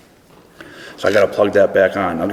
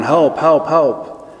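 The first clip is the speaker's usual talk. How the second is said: mild mock yelling; 6 semitones higher, much slower than usual. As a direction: imitate a desperate man shouting from a ditch. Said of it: "So he started yelling".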